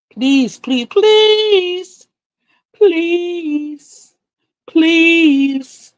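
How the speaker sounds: high, drawn-out pleading please; mock begging. Real, not acted. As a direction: beg in a high sing-song voice. Begging viewers for forgiveness.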